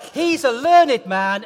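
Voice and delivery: put-on the leader shows the child the call, loud and slow; shout. Shown as a demonstration. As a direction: shout it for the whole church to hear. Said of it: "So you shout out".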